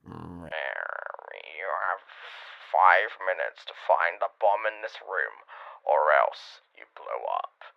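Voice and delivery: low, slow, menacing phone caller; dark put-on villain voice, fading to a rasp; mysterious voice. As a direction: threaten like a movie villain, very deep. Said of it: "a mysterious voice on the phone".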